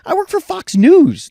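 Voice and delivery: high, slow, indignant retort; imitating his own indignant reply; mock indignant. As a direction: stretch it out, offended. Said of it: "I'm like".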